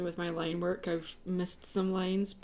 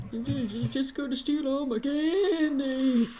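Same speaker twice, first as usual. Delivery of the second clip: slow, higher creaky-old-man imitation; 6 semitones higher, slower than usual. Artist imitating the YouTuber's old man voice. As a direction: drag it out like a grumpy old gamer. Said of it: "He does this old man voice".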